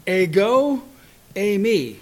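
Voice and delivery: slow, loud Greek declaration; ringing pronouncement; forceful Jesus quote. Retold or imitated. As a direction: proclaim it slowly. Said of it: "But what Jesus said in response".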